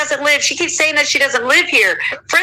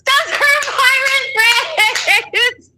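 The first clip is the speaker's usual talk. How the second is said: much higher, very slow, stretched-out mockery; mock whiny voice; much slower than usual. Retelling the man's pirate complaint, high slow mockery. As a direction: drag it out high to ridicule him. Mocking his pirate friends claim.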